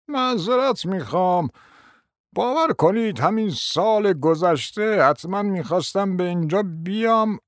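a hint of a doddering prince; old prince. A narrator's reading. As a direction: play a rambling elderly prince. Narrator voices old prince.

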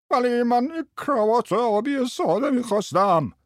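very high, put-on customer whine; squeaky screechy voice. A narrator's reading. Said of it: "با صدای جیغ جیغو گفت".

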